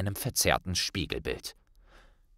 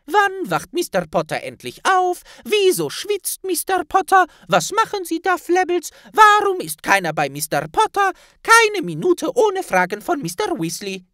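a tiny elf scolding in a squeak; squeaky house-elf; 9 dB louder than usual. Acted. Elf Flabbles chides Ron in a high squeak.

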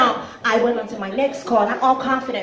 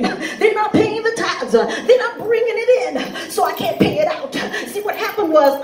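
pastor; 5 semitones higher than usual. Acted. Pastor pleading his excuse to a bill collector, sermon-style. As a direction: preach each excuse in mounting, higher phrases. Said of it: "it's a pastor".

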